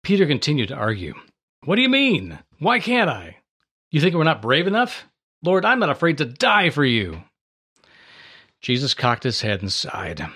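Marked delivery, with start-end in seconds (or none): 1.6-7.2 higher, louder protest. A narrator's reading. Peter arguing with Jesus that he'd follow, higher and louder. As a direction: push back hard, pleading.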